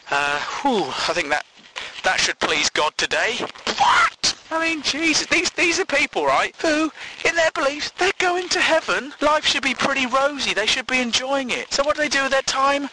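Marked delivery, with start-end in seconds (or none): 3.8-12.9 exasperated rant. Real, not acted. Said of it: "exasperated rant".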